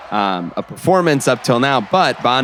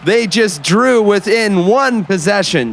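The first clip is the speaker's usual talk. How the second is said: excited call; 8 semitones higher, slower than usual. Acted. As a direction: call the drive as the game tightens. Excited call of late drive.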